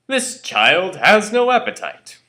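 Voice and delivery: loud, high, slow complaint; exasperated father. Acted. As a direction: declare it loudly to the household, stretching the words. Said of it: "My father would come home every night and say".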